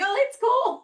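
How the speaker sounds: very high-pitched reaction; mock-casual reassurance. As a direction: play it off in a squeaky high voice. Retells her reaction to everybody appearing.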